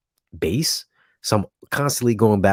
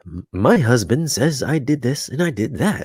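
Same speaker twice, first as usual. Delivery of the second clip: higher, slow imitation of an automated narrator; measured, raised-pitch mock app voiceover; TikTok voiceover voice; 5 semitones higher, slower than usual. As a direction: mimic a preset text-to-speech voice. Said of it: "I don't know where the voice comes from".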